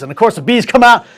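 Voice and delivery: ordinary talk, nothing marked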